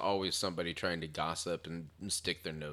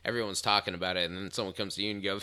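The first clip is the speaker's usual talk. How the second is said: normal talking voice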